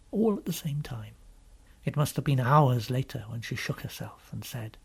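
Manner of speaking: plain reading voice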